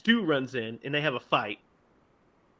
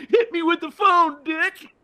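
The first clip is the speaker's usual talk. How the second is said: much higher, strained mock whine; whiny Stu voice; 4 dB louder than usual. Stu griping at Billy, high mock whine. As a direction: squeal it out indignantly. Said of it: "and he goes".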